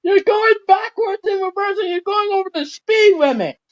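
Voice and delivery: very high-pitched play exclamation; excited alarm. Pretend. Mock alarm during speeding train game.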